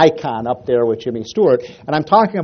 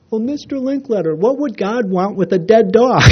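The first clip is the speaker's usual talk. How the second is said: put-on little boy voice; 8 semitones higher than usual. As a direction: speak like a heartbroken six-year-old. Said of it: "the little boy turns to Art Linkletter and says".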